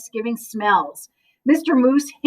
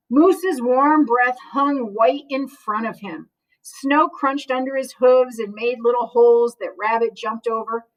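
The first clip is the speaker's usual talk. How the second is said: ordinary delivery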